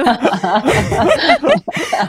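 loud high real laugh; rising real cackle; high burst of laughter. Real, not acted. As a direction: crack up at yourself. Laughing at her own rage.

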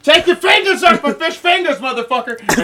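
high-pitched, loud comic outrage; shrieky mock territorial yell; mock furious shout. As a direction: holler it like an outraged fisherman. Acted fishing-rights quarrel.

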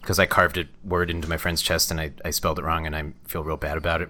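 low, soft, sheepish admission; understated pretend remorse; mock guilty confession. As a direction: confess it quietly, as if writing for advice. Continues the pretend advice letter.

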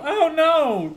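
high mock cry of dismay; Spider-Man cry. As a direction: squeal it like a hammy hero. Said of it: "that he said".

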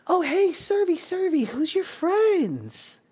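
put-on very high little-girl voice. Little girl runs in to ask about strangers, high childlike voice. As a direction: pipe up high like an eager small child. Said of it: "a little girl runs in".

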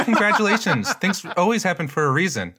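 high, breezy fake cheer; dismissive mock congratulations. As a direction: brush off good news, high. Mocking a dismissive friend.

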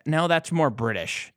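normal talking voice

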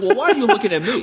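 high-pitched mock exasperation; exasperated retort. Man answering the drunk girl watching him, exasperated retort.

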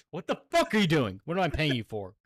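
yelling boss, loud and high; angry boss. Retold or imitated. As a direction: bark at lazy workers. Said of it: "Yeah, it's".

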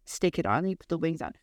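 ordinary talk

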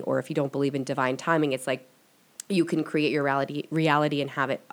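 ordinary talk

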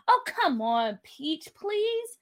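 excited, lifted persuasion. A narrator's reading. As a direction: plead playfully, then push the idea with enthusiasm. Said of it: "McKinley said excitingly".

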